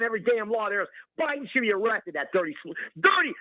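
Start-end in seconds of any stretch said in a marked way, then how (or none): 3.0-3.3 one word shot out high and loud; sudden furious burst. Real, not acted. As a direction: spit out the word dirty in a flash of rage. Angry rant about Biden.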